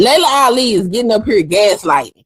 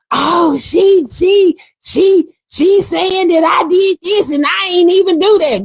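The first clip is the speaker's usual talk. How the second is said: high whiny mock voice; mocking Layla; 7 semitones higher than usual. Vlogger mocking Layla's denial in a higher whine. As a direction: mimic her excuses, higher.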